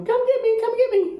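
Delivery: very high squawky parrot call. Retold or imitated. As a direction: mimic the bird calling for rescue. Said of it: "which was a signal".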